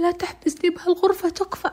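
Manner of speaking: high desperate begging; high pleading cry; frantic plea. Retold or imitated. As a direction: plead not to be locked up. Girl begs not to be locked in.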